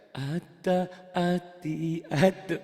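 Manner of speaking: chanted syllables with a Javanese medok lilt; thick Javanese accent. Shown as a demonstration. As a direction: recite with heavy Javanese consonants. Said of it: "Jawanya Ustaz, masih medok Ustaz".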